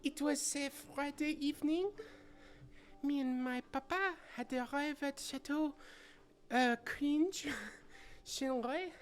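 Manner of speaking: put-on high, girlish, anxious noblewoman; young lady voice. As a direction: ask about papa like a worried girl.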